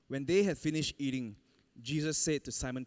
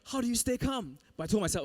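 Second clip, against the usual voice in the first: ordinary talk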